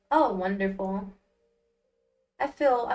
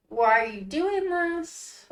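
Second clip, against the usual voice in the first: pleading tryout; 6 semitones higher, slower than usual. Shown as a demonstration. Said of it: "so, we're gonna go with".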